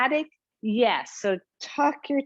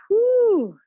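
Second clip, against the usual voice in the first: loud high whoop of relief after effort; whoo of effort; 11 semitones higher, 7 dB louder than usual. Real, not acted. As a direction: let out a big high whoop as the set ends. Instructor finishing a hard move.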